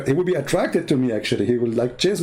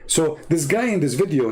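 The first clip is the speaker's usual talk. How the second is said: normal talking voice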